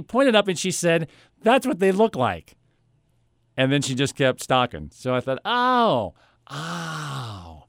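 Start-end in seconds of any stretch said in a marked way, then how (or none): 1.4-2.6 imitated clerk, higher and quick; clerk's brief remark. Store clerk pointing out display phone, higher brisk remark. 5.4-7.6 mock realisation. Customer reacting to clerk's obvious tip, mock enlightenment.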